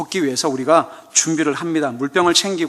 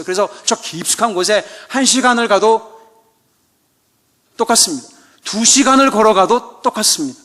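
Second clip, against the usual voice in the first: louder, higher joking sermon delivery; fiery preaching; 3 semitones higher, 4 dB louder than usual. Acted. Preacher urging congregation to bring difficult people, fiery and teasing. Joking exhortation at full force.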